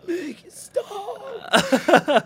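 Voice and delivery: high, slow, laughing cry; hammed-up crying plea to the dog; mock anguished wail.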